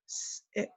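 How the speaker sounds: "s" hissed then "it"; sounding out. Shown as a demonstration. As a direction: add the "s" sound in front of "it". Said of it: "I just added a new sound".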